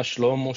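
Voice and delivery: "Shlomo" said SHLOH-moh; "Shlomo" as one in a list of variants; Shlomo pronunciation variant. Shown as a demonstration. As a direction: say "Shlomo" with sh run straight into l. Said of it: "whether it's pronounced as".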